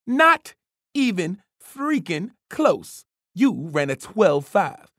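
scolding coach. A narrator's reading. Coach scolding slow time.

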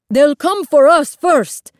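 tense, projected voice; urgent warning. A narrator's reading. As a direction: warn the boat loudly. Urgent dialogue during escape at sea.

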